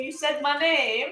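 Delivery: ordinary delivery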